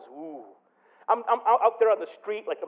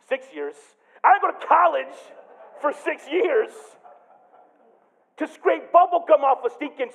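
higher, louder aggrieved rant; indignant inner rant; 10 semitones higher, 7 dB louder than usual. Retold or imitated. A new hire fuming at being sent to scrape gum, indignant. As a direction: vent like a wounded ego. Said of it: "my inner monologue went something like this".

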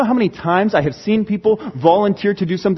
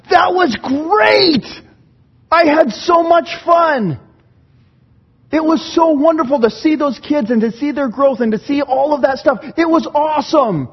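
overflow with joy after camp; gushing excitement; 8 semitones higher, 3 dB louder than usual. Retold or imitated.

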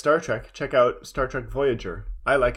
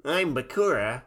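Bakura voice. Retold or imitated. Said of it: "you can just do the Bakura voice".

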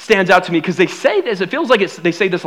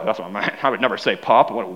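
much lower, softer mock cover-up; sheepish backpedal; 9 semitones lower, 7 dB quieter than usual. Kid quickly disowning his word pop, low sheepish mumble. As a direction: mumble it like hiding embarrassment. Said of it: "I was like".

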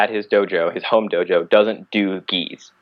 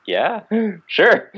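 laughing sarcastic yeah sure; 12 semitones higher than usual. Retold or imitated. Sarcastic reply to easy weekend.

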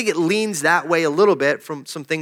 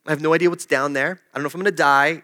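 usual speaking voice

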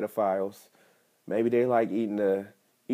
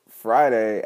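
ordinary talk

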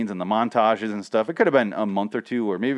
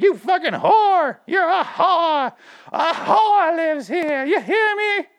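hammed-up, very high drawn-out yelling; over-the-top mock shrieking accusation; Goodfellas-style screeching tirade; 4 dB louder, much slower than usual. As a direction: screech it slowly like an outraged gangster-film wife. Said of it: "like Goodfellas".

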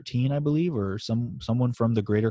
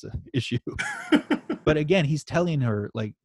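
normal talking voice

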